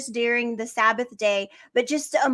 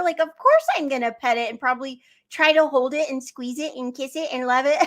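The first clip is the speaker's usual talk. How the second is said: ordinary talk, nothing marked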